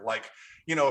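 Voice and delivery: normal talking voice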